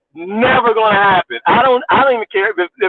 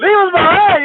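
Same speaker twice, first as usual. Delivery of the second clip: higher, falling disbelief on leave; incredulous echo; 10 semitones higher, 3 dB louder than usual. Retold or imitated. Repeating the host's line to viewers in disbelief. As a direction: repeat it as if you can't believe it. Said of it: "where you gonna leave Don Lemon".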